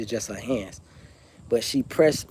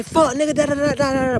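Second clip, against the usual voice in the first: high, loud, shrill-edged mock cussing; angry yelling sister; 14 semitones higher, 4 dB louder than usual. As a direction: yell it like a furious sister. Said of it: "Her sister say".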